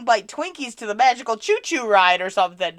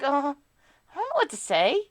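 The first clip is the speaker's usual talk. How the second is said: higher, reluctant mock whine; imitating shy younger self; 13 semitones higher, 6 dB louder than usual. Streamer mocking her shy early self, higher. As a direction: play the nervous beginner who doesn't want to be here. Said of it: "that's how I felt back then".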